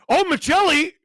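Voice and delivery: loud high excited shout; excited call. Real, not acted. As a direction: cry out at the chance. Excited at a chance.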